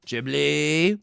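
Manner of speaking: a put-on silly voice for one name; funny voice. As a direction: say the name in a goofy character voice. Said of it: "saying Ghibli in that voice".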